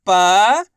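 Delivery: पा loud, held long and rising; single-syllable drill. Shown as a demonstration. Teacher sounding each letter separately, पा held and rising. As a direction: sound out पा alone, swinging it upward. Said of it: "एक अक्षर का उच्चारण".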